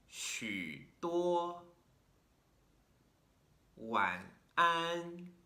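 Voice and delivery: low third tone, then high flat first tone; each word dips low, then pops up to a held high note; tone drill. Shown as a demonstration. Teacher models third-first tone pairs for learners, careful drill. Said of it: "third tone is very low".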